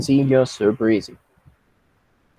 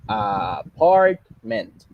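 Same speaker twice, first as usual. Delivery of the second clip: aaa... PART... ment, very slow with PART loudest; exaggerated middle-syllable stress; much slower than usual. Shown as a demonstration. Teacher repeating the example, Apartment slowly stressed on PART. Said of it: "está en medio".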